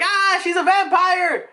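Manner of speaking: put-on high, loud kids shrieking; drawn-out cry of panicked kids; mock scared scream. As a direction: scream it like a frightened child. Kids scream at seeing Bonnie.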